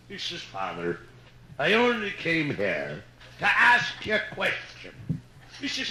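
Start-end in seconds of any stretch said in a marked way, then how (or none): 0.4-4.9 put-on low, slow drunk voice; sheepishly drunk Pat; drunk Irishman.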